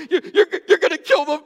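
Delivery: high, loud mock sob of a small girl, laughing; sobbing child. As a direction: wail it like a heartbroken little girl, half laughing. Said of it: "she's like".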